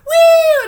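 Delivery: high, loud imitated bar-crowd woo; shrieked "woo"; mock crowd whoop. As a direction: whoop like jeering onlookers. Imitating jeering crowd outside bar.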